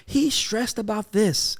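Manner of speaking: high, drawn-out imitation of mother; fretting mother. Mother calling dad about their son, high slow mimicry. Voicing worried mother on phone.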